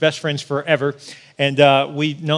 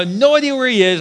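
normal talking voice

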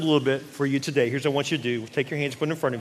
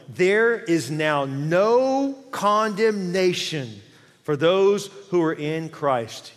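slow, drawn-out scripture declaration; loud and lifted, stretching the key words; emphatic preaching; 4 semitones higher, 5 dB louder, much slower than usual. Acted. Preacher declaring no condemnation to the congregation, emphatic preaching.

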